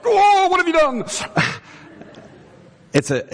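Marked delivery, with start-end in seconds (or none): 0.0-1.1 high, loud mock-horrified dad; hammed-up, high-pitched parental outcry; alarmed dad. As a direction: imitate a dad crying out in shock. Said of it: "And Dad's like".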